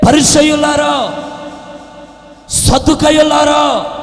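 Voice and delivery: loud, high-pitched sermon cry; full-force preaching. Real, not acted. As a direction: call out forcefully to the congregation.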